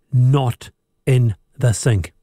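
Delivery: measured, pointed delivery of a pet grievance; slow, deliberate emphasis. Real, not acted. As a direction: spell it out slowly, word by word. Host spells out his pet grievance.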